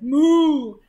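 big loud drawn-out moo; cow's moo. A narrator's reading. Reader voices the cow in the story, loud moo. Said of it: "the cow said".

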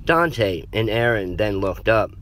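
plain reading voice

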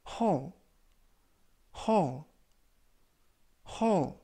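hall said with an open, long o; pronunciation model. Shown as a demonstration. Teacher modelling hall for learners. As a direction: say hall cleanly three times. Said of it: "이라고 발음하시면 되겠습니다".

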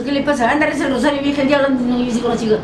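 high, indignant retold quarrel; acted-out insults. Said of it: "porque me insultó". (insultos imitados en tono agudo)

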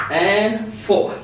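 normal talking voice

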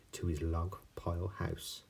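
ordinary delivery, nothing marked

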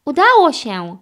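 happy storybook exclamation; delighted. A narrator's reading. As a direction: cheer with relief. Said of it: "ucieszył się Ryder".

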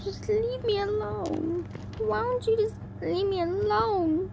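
high, plaintive cry to be left alone; high-pitched, upset begging; hiccuping plea. A narrator's reading. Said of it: "she hiccuped".

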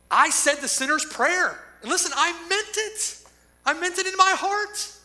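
high, loud pleading sinner voice; a self-assured churchgoer protesting to the Lord; pleading false believer. Acted. Preacher voicing a churchgoer begging the Lord, high and loud.

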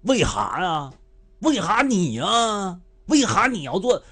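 mock Dongbei scolding of a longtime partner; Northeastern accent. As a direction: bark it out in thick Dongbei dialect. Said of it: "非要用那个东北".

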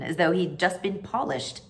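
usual speaking voice

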